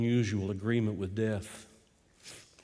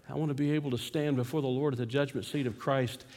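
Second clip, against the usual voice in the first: ordinary delivery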